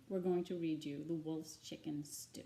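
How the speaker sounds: ordinary delivery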